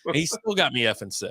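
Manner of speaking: ordinary delivery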